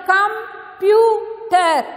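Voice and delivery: "computer" drawn out in pieces for learners; syllable by syllable. Shown as a demonstration. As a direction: say "computer" as com, pyu, ter with gaps. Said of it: "So three syllable".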